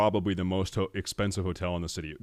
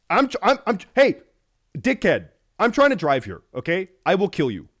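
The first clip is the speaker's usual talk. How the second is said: louder, higher hammed-up yell; mock road rage; 9 semitones higher, 7 dB louder than usual. At a cyclist in Amsterdam traffic, mock road rage yell. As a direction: bark at the cyclist in play fury. Yelling at a cyclist while driving.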